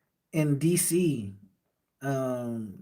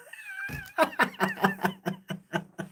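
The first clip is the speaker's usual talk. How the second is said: high-pitched, wheezy laughing fit; amused laugh; 4 dB quieter than usual. Real, not acted. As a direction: laugh in a long, high run of bursts. Laughs at a chat question.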